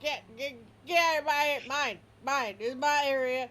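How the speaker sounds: high, loud possessive yelling; a dog shouting his claim over the fence; yapping, shouting dog; territorial dog. Acted. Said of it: "He's like".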